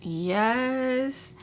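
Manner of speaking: stretched, high questioning call; calling to the door. Retold or imitated. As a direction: draw it out, rising, to the knocker. Answering repeated knocking.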